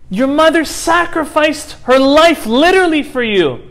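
high, loud, anguished rebuke through tears; a grieving father's cry of reproach; tearful angry outburst. A narrator's reading. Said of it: "crying, tears in his eyes".

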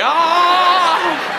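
a loud, strangled mock yelp; choking yelp. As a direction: yelp as if being choked out.

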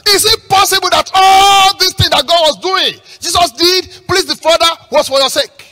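high, loud rhetorical challenge; full-force sermon delivery; preach. Real, not acted. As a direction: shout the question out to the congregation.

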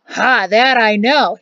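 put-on loud, slow, proud old man; emphatic and pleased with himself. A narrator's reading. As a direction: crow it with pride. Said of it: "he said proudly".

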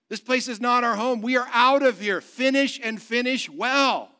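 high, loud, rousing sermon delivery; forceful preaching. Real, not acted. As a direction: proclaim victory to the congregation.

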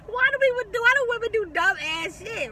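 high and loud, cracking with disbelief; exasperated outburst. Real, not acted. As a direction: throw it up high like you can't believe it. Exasperated rant at women.

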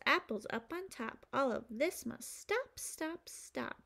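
plain reading voice

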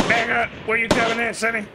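put-on Southern accent. Gamer taunting an enemy in a put-on Southern accent. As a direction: call out to the enemy like a cocky old-timer. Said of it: "So I'm gonna do this accent right here".

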